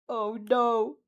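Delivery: sudden shocked gasp of words. Acted. Villain reacts to the girl with shock. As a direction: shock breaks through. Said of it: "a tinge of shock in his voice".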